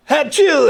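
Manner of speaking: loud high sneeze; fake sneeze. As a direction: blast out a big fake sneeze. [name] fakes a sneeze.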